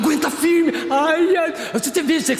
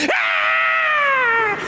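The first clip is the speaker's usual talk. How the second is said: very high, shrill possessed shriek; imitating the possessed woman's scream; demonic scream; 12 semitones higher than usual. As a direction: let out the demon's cry.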